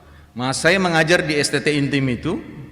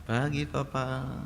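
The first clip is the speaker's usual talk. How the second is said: very soft, slow "Pagi, Papa"; soft right greeting; 12 dB quieter, slower than usual. Shown as a demonstration. Pastor showing how his child must greet him, very softly. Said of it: "Dia harus bilang".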